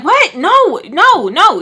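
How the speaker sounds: high, loud protesting mimicry; indignant denial. Answering stranger's drug question, high loud indignant denial. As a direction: re-enact a startled, emphatic denial.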